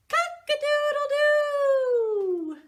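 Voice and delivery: very high, loud, slow crow; crowing rooster. Acted. Parent reading the farm story, rooster crows very high and loud. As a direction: crow it long like a rooster at dawn. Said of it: "crowed the rooster".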